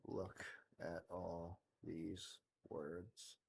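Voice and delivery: low, slow, dazed monotone, dragging each word; tired droning self. Retold or imitated. Streamer mocks his own sleepy coding self, slow low drone. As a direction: drone like a worn-out man staring at words on a screen. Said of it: "me sitting there going".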